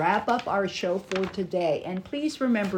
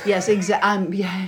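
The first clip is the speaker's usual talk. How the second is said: ordinary talk, nothing marked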